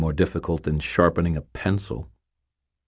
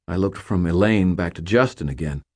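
plain reading voice

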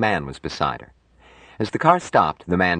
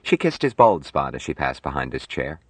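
ordinary delivery

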